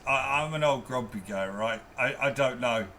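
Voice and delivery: normal talking voice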